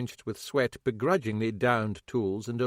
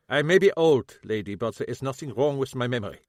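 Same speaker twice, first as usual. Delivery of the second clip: lightly voiced old German man, bitter; old German shoemaker, a hint of German accent; German-accented cobbler; 2 semitones higher, 5 dB louder than usual. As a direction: grumble it with a German accent, grieving. Kappelhoff indignant, German accent.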